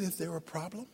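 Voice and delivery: low, soft, composed question; calm phone voice. Retold or imitated. Voice on the elevator phone answering the caller, calm and soft. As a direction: answer the emergency line quietly and evenly. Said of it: "There was one calm man on the elevator".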